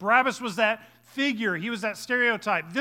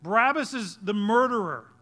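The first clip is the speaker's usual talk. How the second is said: normal talking voice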